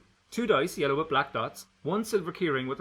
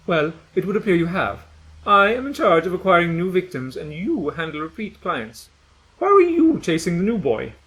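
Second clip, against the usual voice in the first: stern scolding; 4 semitones higher, 9 dB louder than usual. Acted. Gretchen scolding Sam.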